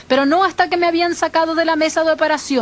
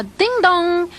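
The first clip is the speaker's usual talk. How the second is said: imitated ringing bell; ship's bell; 2 semitones higher than usual. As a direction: chime it like a deck bell. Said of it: "oí la campana en la cubierta del capitán que decía". (imitando una campana de barco)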